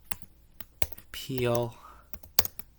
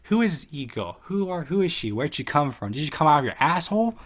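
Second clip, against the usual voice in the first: higher, louder mock jealous rant; 5 semitones higher, 6 dB louder than usual. Haley questioning Sauce about the e-girl, higher louder jealous rant. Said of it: "Haley's just talking to Sauce like".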